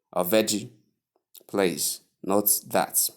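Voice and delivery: ordinary delivery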